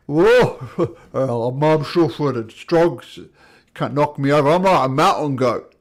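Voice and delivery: loud, bragging monster voice; boastful giant. Acted. Giant bragging to attackers he stays upright, loud. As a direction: boast it loudly, unbudged by the blow.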